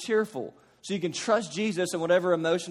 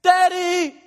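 very high, loud anguished cry; crying out at full force; desperate scream; 11 semitones higher, 7 dB louder, much slower than usual. Retold or imitated. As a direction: wail for daddy. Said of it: "Your first inclination ... should be".

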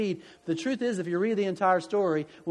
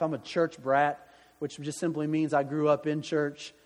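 ordinary talk

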